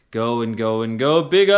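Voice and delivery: loud, swelling build on each go; growing bigger. Shown as a demonstration. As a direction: let it swell to a big, sustained finish. Voice enacts a growing flame.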